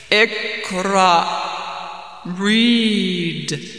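loud, drawn-out booming command. Acted. Mysterious voice commands the trembling man, commanding. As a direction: command it forcefully from the darkness. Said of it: "commanded the voice a third time".

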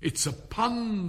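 high, slow, offended explanation. A narrator's reading. King explaining his joke to a silent court, offended. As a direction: spell it out, affronted. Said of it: "added in an offended tone".

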